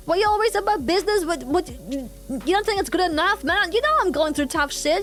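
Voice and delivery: indignant, aggrieved rant, acted out; lashing out. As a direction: lash out defensively as an imagined friend. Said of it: "lashing out back at me".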